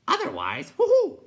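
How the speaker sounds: high loud hooting sigh. A narrator's reading. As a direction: sigh out an owl-like hoo-hoo. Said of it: "the Night Hob sighed".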